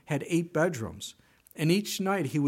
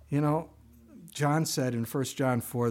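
usual speaking voice

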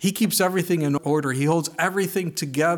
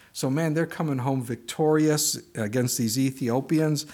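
ordinary talk

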